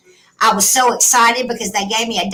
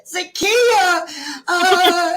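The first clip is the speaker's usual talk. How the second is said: retold crying call; 7 semitones higher, much slower than usual. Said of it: "bawled my eyes out".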